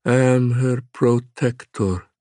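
put-on slow, hollow, vaguely Slavic accent; a menacing monster with a hint of Slavic. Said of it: "His voice was hollow, his accent vaguely Slavic".

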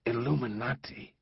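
stunned halting gasp; stammered. A narrator's reading. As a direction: say it shaken, half-disbelieving. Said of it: "he stammered".

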